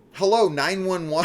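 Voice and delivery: high, loud mock phone greeting; badly acted emergency call opener; imitating a caller. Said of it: "puts it to her head and goes".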